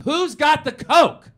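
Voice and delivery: high, loud, drawn-out scream for coke; wild piano-bar holler. Retold or imitated. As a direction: scream it out slowly to the whole bar. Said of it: "he's now screaming".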